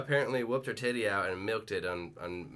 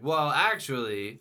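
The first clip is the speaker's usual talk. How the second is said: slow, louder know-it-all correction; mock drunken pedantry; 4 semitones higher, 6 dB louder, slower than usual. Host re-enacting his drunken explanations to people, mock pedantic. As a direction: imitate yourself starting a tipsy lecture. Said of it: "drunkenly, angrily ex-, explain that".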